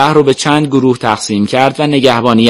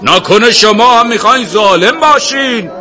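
high, raised rebuking question; loud challenge; 11 semitones higher, 3 dB louder than usual. A narrator's reading. Sheikh challenging the crowd not to be oppressors, loud. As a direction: call it out sharply to the crowd. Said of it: "با صدای بلند گفت".

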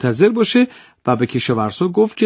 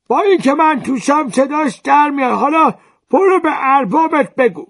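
high, loud nasal-free taunt from inside the jar; cranky old jar-mender; 12 semitones higher, 3 dB louder than usual. Acted. Old mender taunts the farmer from inside the jar, high mocking voice.

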